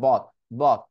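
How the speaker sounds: "বক" as "bought" with no T release; stopped T. Shown as a demonstration. Teacher showing the unneeded final T, stopped T. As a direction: cut "বক" off sharply at the end. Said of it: "লাস্টে টিটা উচ্চারণ করার দরকার নেই".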